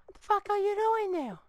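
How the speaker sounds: very high squeaky outraged question; squeaky disbelief. Acted. Repeats it in a squeaky voice.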